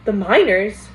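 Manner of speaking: startled yelp, loud; loud surprised yelp on minors. Acted. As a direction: blurt it out in shock. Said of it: "Maniac yelped".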